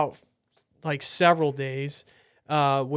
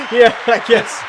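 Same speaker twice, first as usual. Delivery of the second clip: laughing; 7 semitones higher, 4 dB louder than usual. Real, not acted. Laughing at subscriber joke.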